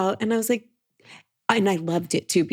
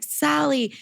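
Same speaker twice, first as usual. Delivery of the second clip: calling out; 7 semitones higher than usual. Retold or imitated. Crowd greets her by name.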